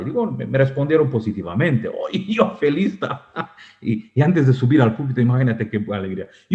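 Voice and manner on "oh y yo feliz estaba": ordinary delivery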